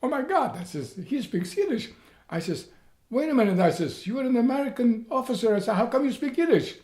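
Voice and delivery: high, loud amazed exclaiming; boy stunned that an American officer speaks Yiddish; freaking out. Retold or imitated. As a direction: blurt it out in disbelief. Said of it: "freaking out".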